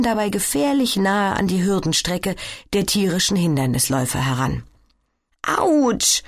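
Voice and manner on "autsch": very high squeaked "Autsch"; a sharp little squeak of pain. Acted. Sina rolling onto a toy block, squeaking. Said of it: "quietscht Sina".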